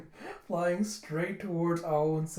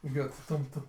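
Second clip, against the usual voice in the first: plain reading voice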